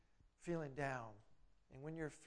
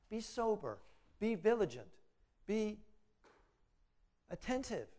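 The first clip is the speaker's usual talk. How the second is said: higher, louder, commanding; urgent exhortation; 5 semitones higher, 7 dB louder than usual. Acted. Preacher urging the church to stay alert, loud and high. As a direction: call them awake, word by word.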